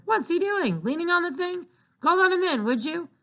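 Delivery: mother fed up with the endless buzzing; exasperated. Acted. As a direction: throw up your hands at the noise.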